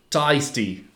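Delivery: tasty with a broad Australian "tie" vowel; Australian accent. Shown as a demonstration. Host demos Australian "tasty" to co-host, lightly Australian. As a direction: say "tasty" as tʰaɪsti, like an Aussie. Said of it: "If you say Thai iced tea like an Australian".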